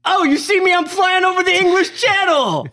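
loud, high mock New York tough-guy accent; Tom Hardy New York accent. Friend mocks Tom Hardy's Venom role in a loud New York accent.

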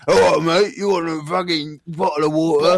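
guttural English bloke, the way it really sounds; English accent. Shown as a demonstration. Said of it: "a bit deeper and a bit more guttural".

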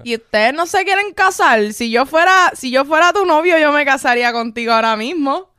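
loud, high, brash come-on. Retold or imitated. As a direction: play the pushy drunk hitting on her. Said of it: "le dijo así a Jessica".

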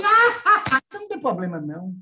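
very high, loud gasp easing into relief. Retold or imitated. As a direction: squeak then settle, mock relieved. [name] relieved, retold.

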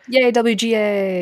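slow, high drawn-out cheer. Real, not acted. Host cheering on Writers Guild, slow high cheer. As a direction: stretch out a celebratory hurrah, high. Cheering ratified union contract.

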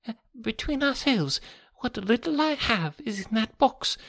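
high, reedy, anxious confiding; nervous resident patient. A narrator's reading. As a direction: confide uneasily in a thin, high voice.

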